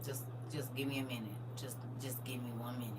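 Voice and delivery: soft, low, drained mutter; weary plea. Retold or imitated. Tired worker begging for quiet, soft and drained. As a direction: beg for a minute of quiet, worn out. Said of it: "You be like".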